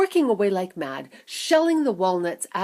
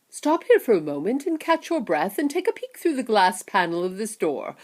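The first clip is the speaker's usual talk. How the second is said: eager showman Wonka warning, raised and loud; excited Mister Wonka; 3 semitones higher, 7 dB louder than usual. Acted. Wonka stopping the children at the door, urgent and loud. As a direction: hurry them along with a bright warning.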